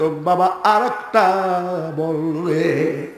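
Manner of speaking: drawn-out, sing-song plea; imitated tearful pleading. Preacher voices village aunties asking for another ghazal, pleading tunefully. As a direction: stretch the last words as if begging tearfully. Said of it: "মা চাচিরা কান্ত".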